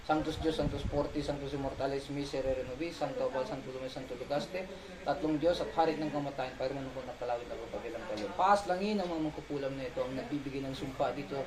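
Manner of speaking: low, hushed ritual recitation; murmured prayer. Real, not acted. Healer prays against the sorcerers cursing the couple, murmured prayer. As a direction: pray under the breath, low and unbroken.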